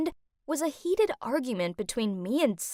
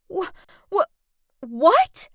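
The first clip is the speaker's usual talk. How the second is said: high, halting disbelief; stunned stammer; 6 semitones higher, 5 dB louder, slower than usual. Acted. Woman told she caused a fatal accident, stunned stammer. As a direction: stammer twice, then burst out louder.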